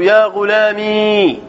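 يا غلامي with the last ya held as a long ee; slow يا غلامي ending in a long, still ee; silent final ya. Shown as a demonstration. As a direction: draw out the "mee" ending of يا غلامي, no vowel after it. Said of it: "بالياء مع التسكين".

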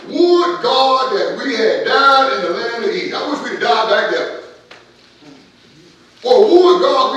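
loud, high preacher voice throughout; rousing the congregation against murmuring; full-force preaching. Acted.

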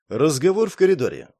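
ordinary delivery

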